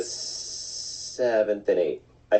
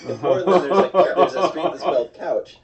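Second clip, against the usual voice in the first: laughing while talking; 6 semitones higher, 5 dB louder than usual. Real, not acted. Laughing through the Couch story.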